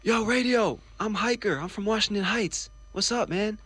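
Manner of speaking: excited high-pitched greeting; calling out. Retold or imitated. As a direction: hail him over the crowd, high and eager. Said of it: "And I said".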